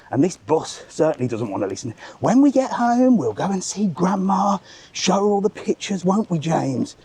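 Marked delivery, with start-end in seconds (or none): 2.2-7.0 high, sing-song loud mum voice; mock loud mum. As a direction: lampoon a mother performing for the whole bus. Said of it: "this woman was talking so loud to her kid".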